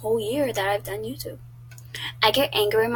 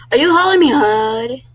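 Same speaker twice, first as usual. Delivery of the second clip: louder, higher, offended question; real affronted challenge, much louder; indignant outburst; 5 semitones higher, 12 dB louder than usual. Real, not acted. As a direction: shoot back, outraged at the quiz. Indignant reaction to quiz result.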